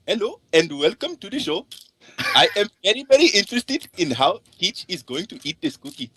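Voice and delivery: high, hammed-up East Indian accent. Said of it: "East Indian accent".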